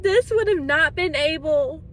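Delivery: ordinary delivery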